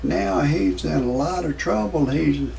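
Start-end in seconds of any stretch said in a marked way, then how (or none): none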